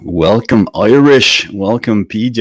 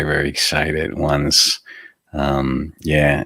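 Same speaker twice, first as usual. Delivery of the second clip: ordinary delivery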